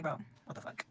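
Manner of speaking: whispered, breathy bro what the fuck; stunned whisper of disbelief; hushed disbelief. Retold or imitated. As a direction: say it under your breath in shock. Retelling reaction to friends.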